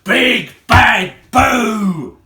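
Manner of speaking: slow, loud, stretched boo; Ugly Mug roars at the children; drawn-out shout. Acted. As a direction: drag out every word to scare them. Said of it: "shouted Ugly Mug".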